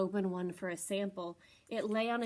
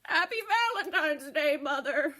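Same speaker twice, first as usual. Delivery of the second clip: higher, louder joyful greeting; loving son goat; Mister Goat voice; 5 semitones higher, 7 dB louder than usual. Acted. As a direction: greet his mother with love.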